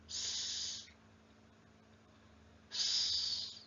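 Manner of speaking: "S" as a long voiceless hiss; soft, sustained "sss" for the letter "S"; phonics letter sound. Shown as a demonstration. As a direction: hold "S" as "sss", not "ess". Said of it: "cara pengucapan atau pronounce alfabet".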